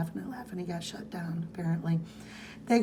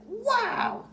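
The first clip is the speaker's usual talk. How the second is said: wow. Retold or imitated. Character's amazed reaction.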